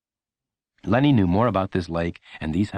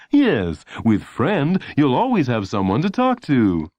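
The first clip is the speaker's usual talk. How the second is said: higher, louder salesy ad read; announcer voice; 5 semitones higher, 3 dB louder, slower than usual. A narrator's reading. Announcer promising a friend to talk to, salesy. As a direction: beam it at the viewer like a pitchman. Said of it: "the announcer's voice saying".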